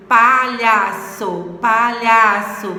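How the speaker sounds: "palhaço" slow and loud, weight on LHA; stress on middle syllable. Shown as a demonstration. Teacher finding the tonic syllable, "palhaço" slowly stressed on LHA. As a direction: drag out "palhaço", leaning on lha. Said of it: "É olhar".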